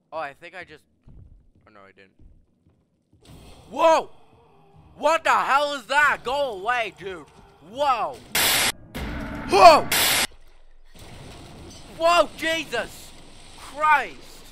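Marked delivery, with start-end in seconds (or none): 3.7-14.4 scared horror game streamer. Real, not acted. Monster appears in game.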